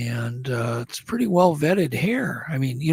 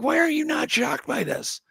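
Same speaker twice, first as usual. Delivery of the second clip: higher, incredulous demand; agitated; 12 semitones higher than usual. Retold or imitated. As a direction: press him, baffled and worked up. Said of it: "I'm getting agitated".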